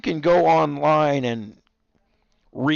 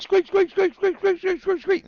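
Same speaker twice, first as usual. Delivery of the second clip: high squeak-squeak mimicking the toy; squeaky toy imitation. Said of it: "four hours of squeak".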